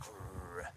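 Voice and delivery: low, quiet mock growl; imitated dog growl. Owner mimicking the dog seeing stuff out here, mock growl. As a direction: growl softly like a puppy acting tough. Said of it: "she's like".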